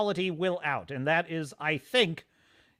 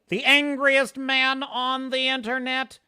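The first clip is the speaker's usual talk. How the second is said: mock dramatic; 7 semitones higher, 5 dB louder, slower than usual. Dramatic label for a friend.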